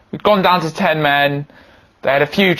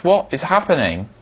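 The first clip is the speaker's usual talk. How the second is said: usual speaking voice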